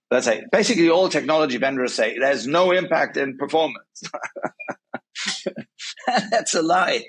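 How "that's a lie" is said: high chuckle breaking into the words; laughing. Real, not acted. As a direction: laugh at an obvious falsehood. Laughs at vendors' claim.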